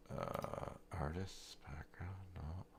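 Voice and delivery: a barely voiced mutter while hunting through menus; whispered to himself. Real, not acted. Searching the settings alone, whispering to himself. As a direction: whisper the options under your breath.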